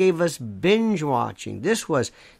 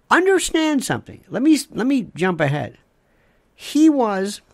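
usual speaking voice

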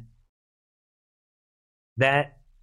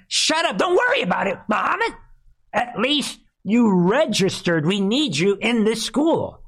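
high-pitched hammed-up yelling; mock exasperated scolding; 8 semitones higher than usual. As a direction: scold the reluctant recruit, voice pushed way up. Handler scolding recruit in skit.

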